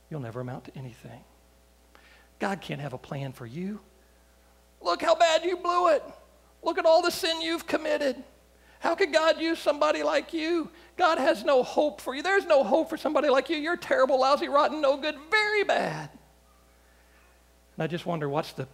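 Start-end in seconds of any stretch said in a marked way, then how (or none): 0.1-3.8 low, quiet put-down; hushed, belittling voice of doubt; discouraging inner voice. Acted. 4.8-16.1 high-pitched mocking accuser; shrill-pitched scolding voice of self-doubt; condemning inner voice.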